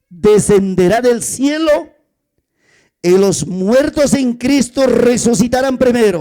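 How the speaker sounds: loud, slow sermon delivery; fiery preaching. Real, not acted. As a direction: proclaim it to the congregation. Preaching at full force. (predicación fuerte y pausada)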